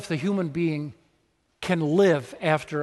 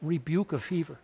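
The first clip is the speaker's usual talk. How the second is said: "Rebuke a fever" said softer, stress on "rebuke"; hushed repeat; 6 dB quieter than usual. Shown as a demonstration. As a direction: murmur "Rebuke a fever" in awe. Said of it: "Think of that".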